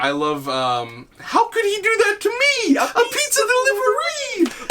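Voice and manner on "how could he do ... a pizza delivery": very high, strained falsetto character voice; squeaky quoted voice. Retold or imitated. Quoting a favorite video line to his friend, in a high squeaky falsetto. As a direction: squeak it out like a cartoon character in distress. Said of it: "That is probably one of mine".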